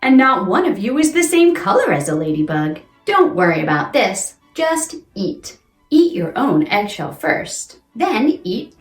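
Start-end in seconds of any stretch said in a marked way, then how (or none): none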